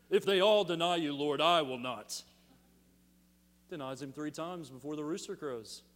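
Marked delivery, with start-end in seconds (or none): 0.1-2.2 a loud, boastful Peter vow; brave Peter. Retold or imitated. Peter swearing loyalty to Jesus, loud boast. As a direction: bellow the vow as bold Peter. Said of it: "Brave Peter".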